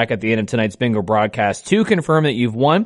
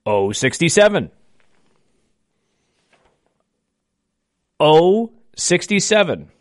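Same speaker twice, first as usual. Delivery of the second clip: slow, deliberate number calling; bingo caller; slower than usual. Acted. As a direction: call each ball slowly with falling ends. Calls each bingo ball twice.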